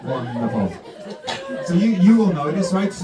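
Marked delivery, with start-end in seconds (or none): none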